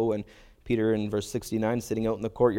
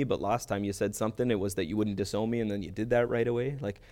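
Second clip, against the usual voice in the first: normal talking voice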